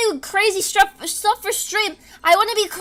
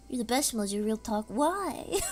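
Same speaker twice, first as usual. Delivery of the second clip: ordinary talk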